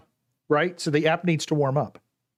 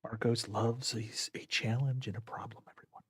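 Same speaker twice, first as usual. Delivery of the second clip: golf commentator; 11 dB quieter than usual. Acted. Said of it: "my golf voice".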